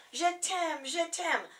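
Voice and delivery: high, delighted French cry; cried in gratitude. Retold or imitated. Delighted shopper crying thanks to the shopgirl, high and gushing. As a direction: gush your thanks in French. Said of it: "I cried in gratitude".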